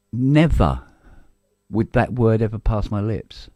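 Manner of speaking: never punched, the rest held firm; stress on never. Real, not acted. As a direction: lean hard into never. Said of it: "emphatic refusal".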